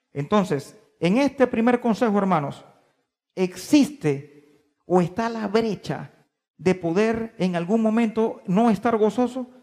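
normal talking voice

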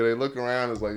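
ordinary delivery

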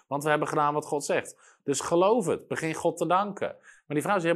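ordinary talk, nothing marked

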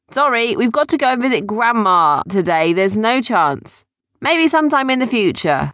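high, loud motherly refusal; calling from inside. Acted. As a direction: call out a firm apology to those outside. Mum calls from inside the boat.

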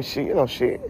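ordinary talk

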